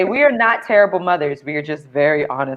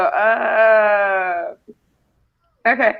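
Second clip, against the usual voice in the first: awkward uh okay; 3 semitones higher, much slower than usual. Retold or imitated. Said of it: "I just go".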